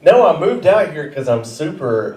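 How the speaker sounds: usual speaking voice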